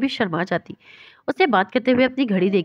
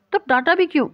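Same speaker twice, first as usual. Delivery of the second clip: a mild teary whine, jumping higher on the last question; childish tearful insistence; 8 semitones higher than usual. A narrator's reading. Girl protesting to him on the phone, a mild teary childish whine. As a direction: sulk like a child with a hint of tears. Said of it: "بچوں کی طرح روئے ہوئے اس سے ضد کرنے لگی".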